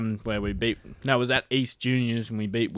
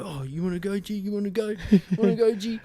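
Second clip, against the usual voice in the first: mock tough-guy challenge; 8 semitones higher than usual. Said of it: "he's like".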